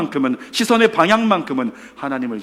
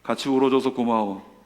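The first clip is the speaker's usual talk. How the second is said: slower, lower, tender thanks; calmed-down gratitude; 4 semitones lower than usual. Retold or imitated. Friend done crying thanks his companion, tender and subdued. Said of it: "울음을 그친 그 친구가".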